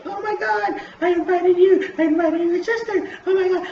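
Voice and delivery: put-on very high, loud squealing girl voice. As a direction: squeal it like an excited teen girl. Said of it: "And then [name]'s like".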